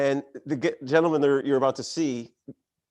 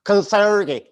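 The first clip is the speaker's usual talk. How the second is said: loud, higher Southern-accented imitation; thick Southern accent; 5 semitones higher, 8 dB louder, slower than usual. Imitating friend from Albemarle, thick Southern accent. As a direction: lay a Southern drawl on "Consergi". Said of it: "you gotta put a Southern accent on it".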